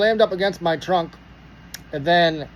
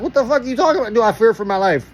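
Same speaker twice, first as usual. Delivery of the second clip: high, louder incredulous retort; indignant disbelief; 5 semitones higher, 4 dB louder, faster than usual. Retold or imitated. Driver asked if he fears for life, indignant. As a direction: act out being floored by an absurd question. Acted indignant reply to cop.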